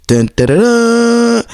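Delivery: high mock-heroic fanfare; sung fanfare. Announcing Cape Snot Kimmy, mock fanfare. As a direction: sing a slow superhero sting up high.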